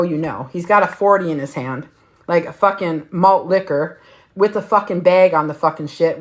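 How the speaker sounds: ordinary delivery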